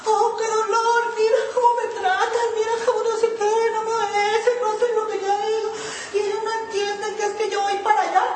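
high, singsong self-pitying wail; hammed-up tearful complaint to God, half sung; mock whining lament. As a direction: parody her own whimpering prayer, pitched high and sung out. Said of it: "iba a llorar y a chillarle".

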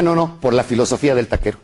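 ordinary delivery

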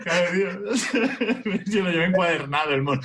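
high, laughing through the words. Real, not acted. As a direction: crack up while saying it. Laughs at his own overkill. (entre risas)

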